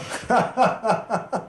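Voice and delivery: a burst of high laughter; bright laugh breaking out; amused laugh. Real, not acted. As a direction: laugh at the memory. Laughing at his own memory.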